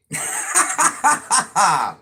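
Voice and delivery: a burst of loud laughter. Real, not acted. Mother on live laughing loud at the yelling kids.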